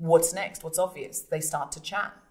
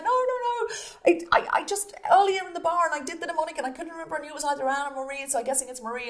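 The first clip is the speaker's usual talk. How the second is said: much higher, fast babbling denial; frantic high excuse; 11 semitones higher, 4 dB louder, faster than usual. Retold or imitated. Man frantically explains the mnemonic to her, high and babbling. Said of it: "he's like".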